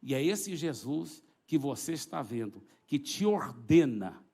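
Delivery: slow, low-pitched order, firmly weighted; low restrained command. Retold or imitated. Preacher ordering the demon out, low, slow and firm. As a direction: command slowly in a low register. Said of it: "Falei baixinho assim".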